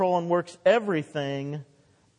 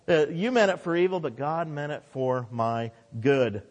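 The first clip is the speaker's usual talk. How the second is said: usual speaking voice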